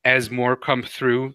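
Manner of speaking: ordinary talk, nothing marked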